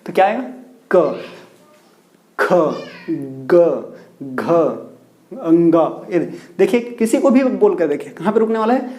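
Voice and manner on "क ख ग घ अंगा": क, ख, ग, घ, अंगा sounded slowly, each held from the throat; deliberate throat-sound drill; letter sound demo. Shown as a demonstration. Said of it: "बोल करके देख लीजिए".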